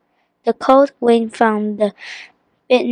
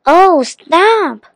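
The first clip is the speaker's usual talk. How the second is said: high, mildly raised call; 7 semitones higher than usual. A narrator's reading. Nadine sees the magic key glow, calling out. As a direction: call out with lifted pitch. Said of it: "called Nadine".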